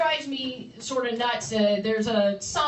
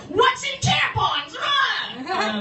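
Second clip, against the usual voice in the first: high, loud mock alarm; panicked shout; 7 semitones higher, 4 dB louder, much slower than usual. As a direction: yell like a panicked newsman. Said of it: "they'd be like".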